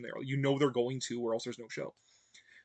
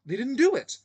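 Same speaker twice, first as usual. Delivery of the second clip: higher, louder frustrated outburst; exasperated; 9 semitones higher, 6 dB louder than usual. Real, not acted. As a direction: vent the letdown. Exasperated rant about Valeyard.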